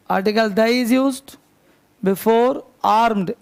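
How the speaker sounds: very slow dictation pace; teacher dictating grammar rule. Shown as a demonstration. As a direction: drag each word for students to write. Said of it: "teacher dictating slowly".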